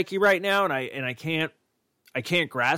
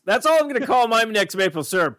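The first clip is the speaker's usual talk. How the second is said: excited joke; 7 semitones higher, 4 dB louder than usual. Pretend.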